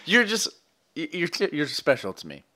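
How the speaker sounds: usual speaking voice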